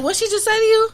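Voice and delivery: high, loud mock confrontation; confrontational demand. Recounting how she confronts a girl, high loud challenge. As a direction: fire it back like a challenge to a rival. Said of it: "I be like".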